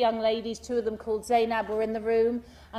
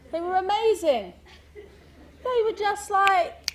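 excited; 10 semitones higher, 5 dB louder, slower than usual. Real, not acted. Delighted by the women.

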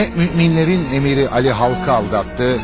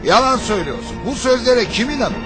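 angry; 11 semitones higher than usual. Acted. Accused of lying, indignant reply.

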